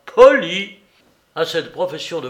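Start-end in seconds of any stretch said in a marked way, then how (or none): 0.1-0.8 loud, high burst of disbelief; outraged echo. A narrator's reading. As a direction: throw the word back indignantly. Outraged echo of the other's word.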